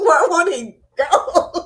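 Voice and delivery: slow, amused and laughing; laughing about the troll's new names; laughing question. Real, not acted. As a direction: wonder aloud through giggles.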